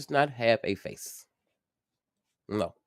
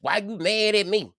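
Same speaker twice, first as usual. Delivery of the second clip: higher, louder mock of an annoyed partner; imitating an upset partner; 11 semitones higher, 5 dB louder than usual. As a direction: whine it like someone caught off guard. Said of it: "if they get mad at me and like".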